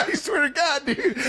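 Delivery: high, laughing through tears; barely able to speak for laughing; laughing hard. Real, not acted.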